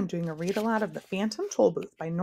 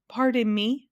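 higher, polite little interjection; polite interruption; 5 semitones higher, 4 dB louder, slower than usual. A narrator's reading. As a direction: cut in as politely as possible. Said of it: "coughed Milo as politely as possible".

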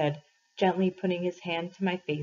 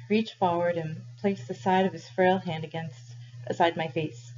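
ordinary delivery

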